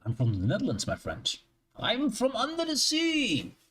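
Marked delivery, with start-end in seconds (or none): 1.8-3.6 high, slow, hammed-up sea-dweller boast; mock dramatic line. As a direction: stretch it out high like a joke. Joking about being Dutch.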